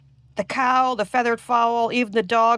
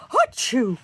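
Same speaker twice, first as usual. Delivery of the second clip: mock sneeze; 4 semitones higher, 3 dB louder than usual. Flea sneezes.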